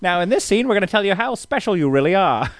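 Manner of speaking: high, coaching director; old-timey director voice. Pretend. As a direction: direct the actress grandly.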